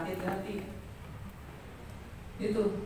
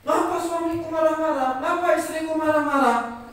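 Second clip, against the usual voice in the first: high, loud mock complaint; 11 semitones higher, 5 dB louder than usual. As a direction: wail it like an upset spouse. Acts out spouses complaining of anger. (menirukan keluhan pasangan, tinggi dan keras)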